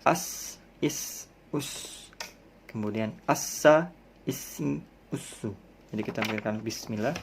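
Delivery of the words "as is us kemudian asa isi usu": As, is, us with a long voiceless hiss. Shown as a demonstration. Teacher to class, hissing sin drill. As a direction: stretch the hiss of As. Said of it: "ada desisnya".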